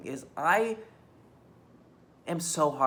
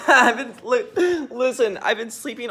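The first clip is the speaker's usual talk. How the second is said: higher, louder, laughing through the words; 7 semitones higher, 9 dB louder, slower than usual. Real, not acted. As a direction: chuckle at your own situation. Laughing about sleeping on couch.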